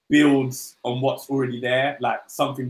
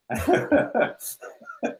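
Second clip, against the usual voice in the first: high helpless burst of laughter; cracking up at the joke; real laughter. Real, not acted. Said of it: "Sorry, I'm really laughing".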